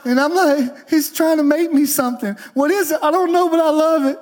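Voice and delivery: high, urgent testimony preaching; impassioned preaching. Acted. Preacher testifying about crying in grocery stores, high and fervent. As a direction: fire up the congregation with joy.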